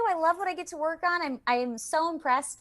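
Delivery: high, quick and eager; excited gush. Real, not acted. Voice actor tells host she loves her work, quick and high. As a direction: rush it out, still beaming. Gushing about her job.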